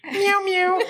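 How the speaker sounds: high mock meowing; cat meow. Imitating the cat.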